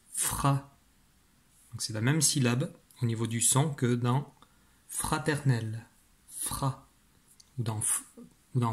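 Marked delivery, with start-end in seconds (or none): 0.1-0.8 "Fra" with a drawn-out f; syllable dictation. Shown as a demonstration. Teacher dictates the fifth syllable, drawn-out "Fra". 6.3-6.9 "Fra" soft, with a drawn-out f; syllable dictation. Shown as a demonstration. Teacher singles out a syllable from fraternel, soft drawn-out "Fra".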